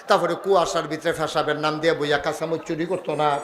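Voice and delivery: low, even, rolling recitation; recited list. Retold or imitated. Disciples answering their hujur, retold as a low rolling list. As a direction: reel off each item on the same low note.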